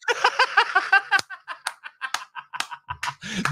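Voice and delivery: long, high burst of laughter; real high laugh. Real, not acted. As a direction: crack up at what he hears. Laughs at the clip.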